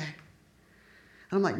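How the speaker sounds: ordinary talk, nothing marked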